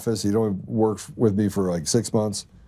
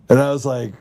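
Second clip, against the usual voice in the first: normal talking voice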